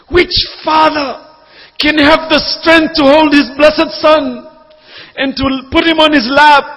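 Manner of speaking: a preacher's rising cry; impassioned preaching. Acted. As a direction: drive each phrase home from the pulpit. Preaching at full force.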